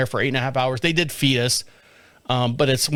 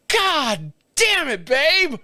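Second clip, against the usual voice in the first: exasperated; 11 semitones higher, much slower than usual. Retold or imitated. Reacting to wife's confession.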